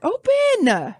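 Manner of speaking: high-pitched plea at a stubborn bottle; exasperated cry. Real, not acted. Host battling a wine bottle, exasperated high cry. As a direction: strain it out high in frustration.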